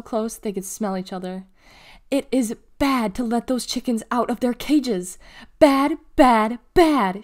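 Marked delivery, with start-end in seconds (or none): 2.1-7.2 loud scolding, building to a roar. A narrator's reading. Officer scolding Delly for freeing chickens, roared. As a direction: hammer each bad louder and higher. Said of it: "she roared".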